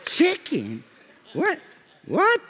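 high, incredulous questioning; mock disbelief. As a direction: react in mock astonishment at what you heard. Family reacting to his accented order.

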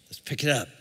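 ordinary delivery

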